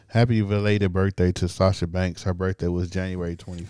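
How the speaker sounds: low, slow announcement voice; other voice. Pretend. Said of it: "let me send in my other voice".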